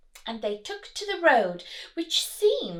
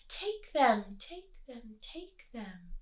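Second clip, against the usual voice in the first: fading echo, each softer; echo from the cave; 5 dB quieter, slower than usual. Acted. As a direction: let it fade like an echo. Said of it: "came back a welcome sound".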